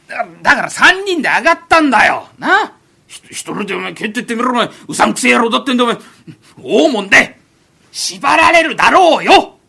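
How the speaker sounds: exasperated repeat. Acted. Friend repeats the scheme loudly out of exasperation. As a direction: hammer the plan into a dense friend.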